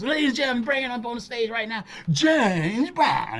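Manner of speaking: high, showy announcement; MC announcing. Retold or imitated. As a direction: call him up like a headliner. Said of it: "So he go up".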